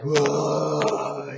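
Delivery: long imitated wail; drawn-out moan of a hurting bear. Imitating the bear in bad shape, wailing. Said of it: "he is just moaning and wailing".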